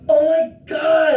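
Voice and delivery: loud, high, slow startled cry; startled exclamation. Real, not acted. Startled by a loud thunder clap, real loud cry. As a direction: jump at the thunder.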